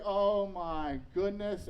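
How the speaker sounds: very slow, loud, drawn-out "oh my goodness"; drawn-out dismay. Retold or imitated. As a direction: stretch it out like a sinking realization. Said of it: "And I'm like".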